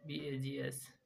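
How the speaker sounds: normal talking voice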